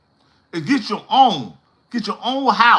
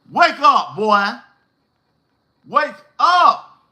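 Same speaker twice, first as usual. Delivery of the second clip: raised, punchy wake-up call; scolding wake-up call; 3 semitones higher, 3 dB louder, slower than usual. Acted. Telling off the crying young man, scolding wake-up call. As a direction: call out sharply to rouse him.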